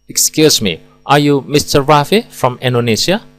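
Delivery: "Rafi" and "Indonesia" lifted high to check who it is; rising confirmation question. Shown as a demonstration. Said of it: "Raffi dan Indonesia dilafalkan dengan nada naik". (bertanya memastikan, nada naik pada "Rafi" dan "Indonesia")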